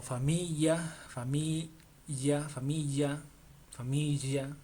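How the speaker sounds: familia said fa-MEE-ya, drawn out; learner's mispronunciation. Shown as a demonstration. Teacher imitates how learners may sound at home, mispronounced. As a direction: let the l of familia slip into a y. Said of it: "Quizás te suena como".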